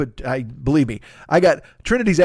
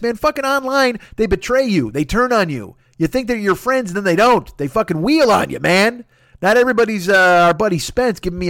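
higher, louder ranting; heated rant; 7 semitones higher, 4 dB louder than usual. Real, not acted. Comedian venting to listeners about online betrayal, heated rant. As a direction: vent about fickle online fans.

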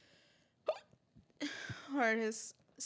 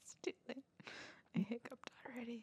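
breathy whisper; 5 semitones higher, 8 dB quieter, faster than usual. Real, not acted. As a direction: whisper the admission. Whispered admission.

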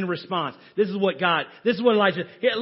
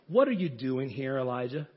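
soft voice of God; 8 semitones lower, 7 dB quieter than usual. Acted. God's voice questions Elijah in cave.

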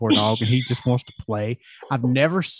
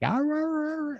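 high yowling husky talk, way up in pitch; imitated husky howl; 5 dB quieter than usual. Owner imitating his husky's howling talk, high yowl. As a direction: mimic the dog's sing-along howl. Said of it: "I'll get him to talk by singing horribly".

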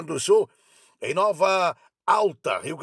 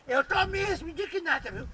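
put-on high-pitched, offended old-lady voice; indignant grandma; 9 semitones higher, 4 dB quieter than usual. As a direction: huff it out like an outraged granny. Grandma declares her outrage.

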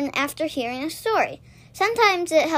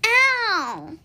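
higher, sharp little yelp; rabbits crying ow; 7 semitones higher, 4 dB louder than usual. A narrator's reading. Rabbits hit by acorns, a high yelp. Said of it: "cried the little rabbits".

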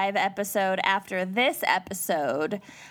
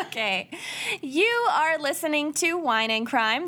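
ordinary talk

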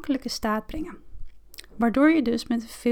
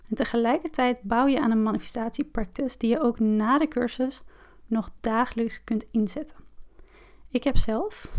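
ordinary talk

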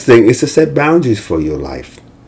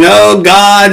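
mock desperate cry; 7 semitones higher, 3 dB louder than usual. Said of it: "yelling and screaming and crying".

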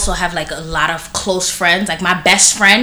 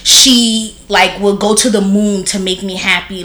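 ordinary talk

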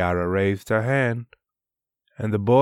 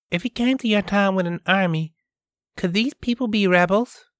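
put-on higher, quick questioning girl; a girl asking about rebels, higher voice; curious girl voice; 11 semitones higher, much faster than usual. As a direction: ask it as an eager young girl. Kiara voiced in story.

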